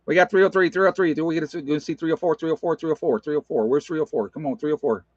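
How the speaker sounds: fast, high auctioneer chant of numbers. Pretend. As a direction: rattle off the bids like an auctioneer. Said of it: "That's an auctioneer".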